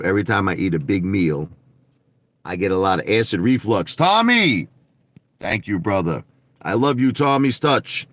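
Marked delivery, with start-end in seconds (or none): 3.9-4.7 high, excited name call; pitched-up greeting shout. Real, not acted. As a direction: hail a friend loudly across the room. Hailing a viewer by name.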